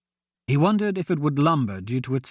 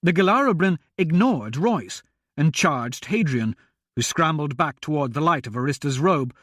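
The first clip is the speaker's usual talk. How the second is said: plain reading voice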